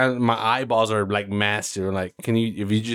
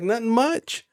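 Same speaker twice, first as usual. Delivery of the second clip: feigned casual; 12 semitones higher than usual. Retold or imitated. Himself voiced, feigning calm.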